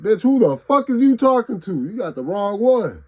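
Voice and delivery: high, loud furious yell; angry outburst. Retold or imitated. Imagined snapping at a rude coworker, high furious yell. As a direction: let the dog out, snap at them. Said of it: "that dog in me just wanna be like".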